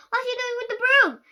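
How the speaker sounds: high, loud frightened question; alarmed cry. Acted. Alarmed kid about the broom.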